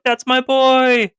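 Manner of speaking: high, slow wailing cry of a clinging mother; mock wailing mom. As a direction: ham up a desperate mom clinging to her boy. Mom clinging to son, retold.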